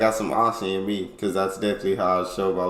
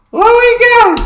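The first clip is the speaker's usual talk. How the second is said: loud call; 16 dB louder, much slower than usual. Acted. Reaction video intro shout.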